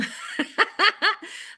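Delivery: a high, bright giggle at her own story. Real, not acted. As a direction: burst into a giggle after the scolding.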